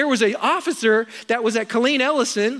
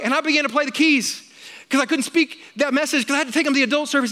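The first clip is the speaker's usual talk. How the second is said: ordinary talk, nothing marked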